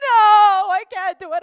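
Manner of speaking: loud, high cry of refusal; anguished no. Acted. John torn about selling his books, crying no. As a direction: burst out in distress.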